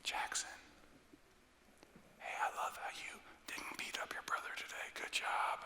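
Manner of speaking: put-on soft whisper right into a child's ear. Parent praising son at bedtime, intimate whisper. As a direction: whisper close, tenderly, to a sleepy child. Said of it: "we whisper in their ear".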